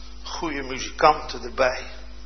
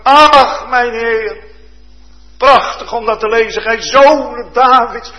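much louder and higher, fervent preacher; preaching at full force; 9 semitones higher, 9 dB louder than usual. Acted. Preacher stirring the congregation with the blind man's cry, loud and higher. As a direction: thunder it out, building in passion.

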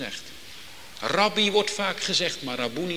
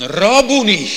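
luid en hoger uitgeroepen, vol vreugde; blijde erkenning; 5 semitones higher, 12 dB louder than usual. Retold or imitated. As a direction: roep het uit als wie haar meester herkent. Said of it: "roept ze hem met blijde erkentenis aan". (luide, blije uitroep van Maria)

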